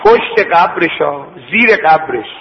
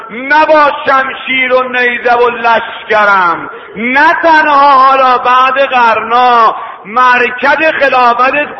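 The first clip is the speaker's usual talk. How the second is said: preaching at full force; 6 semitones higher than usual. Acted. Preacher voicing Imam Hussein defying Yazid, high impassioned cry. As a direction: cry it out over the congregation, stretching the words.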